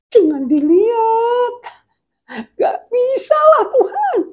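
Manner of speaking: very high, loud wail; hammed-up tearful squeal, drawn out; panicked pleading. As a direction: beg God in a shrieking whine. Acts man begging God not to look.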